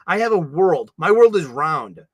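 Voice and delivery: ordinary talk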